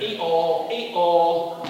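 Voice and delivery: loud, drawn-out "ee-aw"; playful spoken hee-haw; donkey bray. Acted. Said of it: "The donkey going".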